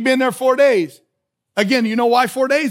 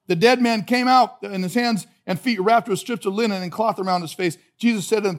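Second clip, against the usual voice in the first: ordinary talk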